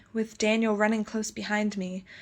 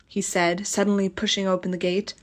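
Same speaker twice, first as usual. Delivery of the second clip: usual speaking voice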